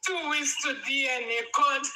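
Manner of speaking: ordinary talk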